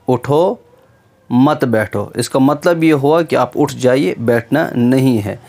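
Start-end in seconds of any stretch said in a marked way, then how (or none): 0.1-2.1 उठो on its own, long pause, then मत बैठो; pause after उठो. Shown as a demonstration. Teacher repeating the comma example, pausing after उठो. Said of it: "comma डालेंगे".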